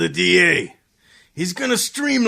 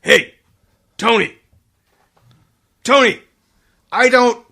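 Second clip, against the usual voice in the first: calling out; 6 semitones higher, 5 dB louder than usual. Acted. Hellman calls out on phone.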